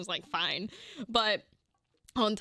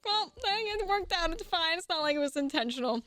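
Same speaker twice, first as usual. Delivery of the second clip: light high mock reassurance; 7 semitones higher than usual. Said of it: "I was like".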